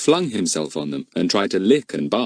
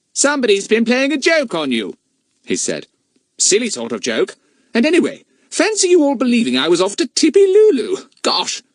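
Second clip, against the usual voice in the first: put-on teasing boy voice; 5 dB louder than usual. A narrator's reading. Fatty speaks in story.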